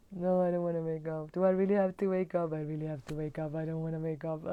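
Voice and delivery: fading, groaning reluctance to get up; mock sleepy whine trailing off; drowsy complaining under the covers; whiny snoozer. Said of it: "the pattern of snoozing".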